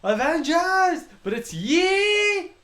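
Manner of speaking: hammed-up movie hero calling the troops; mock hero battle cry. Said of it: "where he's like".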